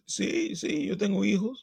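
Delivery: high-pitched mock Spanish-speaker voice; attempted Latin accent. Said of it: "based on the accent that I'm attempting".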